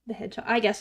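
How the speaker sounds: ordinary delivery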